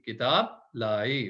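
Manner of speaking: किताब लाई read very slowly; stretched teaching phrase; slow model phrase. Shown as a demonstration.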